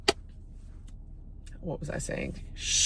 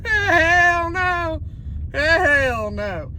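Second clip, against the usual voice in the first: laughing refusal; 9 semitones higher, 4 dB louder, much slower than usual. Real, not acted. Police pulling out guns.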